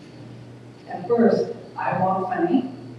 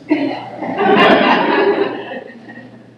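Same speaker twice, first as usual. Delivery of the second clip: laugh; 8 semitones higher, 8 dB louder than usual. Real, not acted. Laughs after joke reference.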